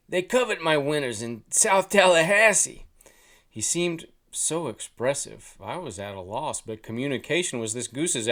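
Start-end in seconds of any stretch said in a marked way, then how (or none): 0.1-2.8 put-on high, loud Jersey-accented goose. A narrator's reading. Goose bragging of his Canadian friends, loud Jersey accent. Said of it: "think his accent is classy".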